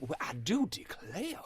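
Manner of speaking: slow, hammed-up Southern accent; mock the actor's thick Southern accent, slowly; Foghorn Leghorn Southern accent. Mocking the movie's Edgar Allan Poe, a slow hammed-up Southern accent. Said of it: "He was doing a great Foghorn Leghorn impression".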